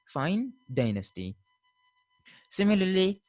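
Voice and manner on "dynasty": Dynasty as "DYE-nasty", lower; American pronunciation. Shown as a demonstration. Teacher repeating the American form, Dynasty as "DYE-nasty". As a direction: eye diphthong in Dynasty. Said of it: "while the Americans say".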